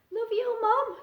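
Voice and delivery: high, small sleepy owlet; a sleepy little one saying goodnight; lightly voiced tired owlet. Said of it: "in a tired little voice".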